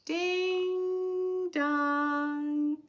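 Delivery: gasp, then long high sung doorbell. Acted. Reader to children, gasping at the doorbell. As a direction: chime the bell slowly with surprise.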